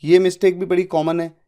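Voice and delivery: ordinary talk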